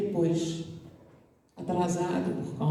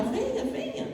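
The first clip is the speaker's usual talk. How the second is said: put-on much higher, soft childlike coaxing; beckoning little girl; 4 dB quieter than usual. The girl quietly urges the student down the corridor, high and soft.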